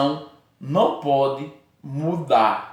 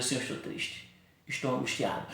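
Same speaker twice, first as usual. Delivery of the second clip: hushed, much softer whispered lament; sad prayer; 5 semitones lower, 12 dB quieter than usual. Retold or imitated. Preacher shows an honest sad prayer, soft whisper. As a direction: confess your sadness under your breath. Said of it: "Com o coração triste".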